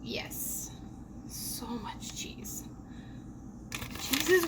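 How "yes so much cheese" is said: hushed indulgent whisper. Real, not acted. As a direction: whisper it like a secret. Whispering over cheese.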